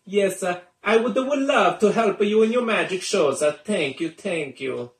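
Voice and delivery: high Italian chef puppet voice; Italian accent. Acted. Puppet thanks the magician in Italian accent. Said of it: "Anyways, Chef Salami".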